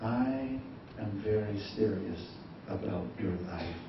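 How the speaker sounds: God speaking quietly and seriously. Pretend. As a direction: speak it low and grave as God. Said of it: "Tuhan itu sedang mengatakan".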